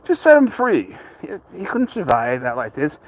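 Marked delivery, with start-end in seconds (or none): 0.0-1.0 high, put-on mocking voice of critics; mocking other people. Owner mocking people who say free the cat, in a high mocking voice. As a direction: parody the know-it-alls. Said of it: "people are like".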